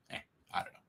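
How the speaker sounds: breathy whisper to himself; whispered aside. Real, not acted. Host doubting his two-whiskey plan, whispered. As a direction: let it slip out under the breath.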